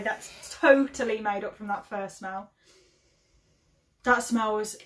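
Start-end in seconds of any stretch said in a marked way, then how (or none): none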